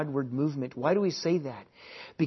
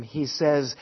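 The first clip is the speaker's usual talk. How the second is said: ordinary delivery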